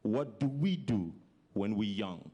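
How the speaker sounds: usual speaking voice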